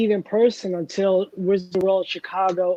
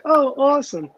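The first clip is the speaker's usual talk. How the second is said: retold delight; 7 semitones higher than usual. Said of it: "I'm like".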